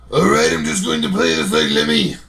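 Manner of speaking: gravelly-free low [name] rasp, lightly done; [name] impression. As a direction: mumble it like [name]. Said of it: "hearing you do a [name]".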